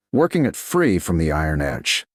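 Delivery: plain reading voice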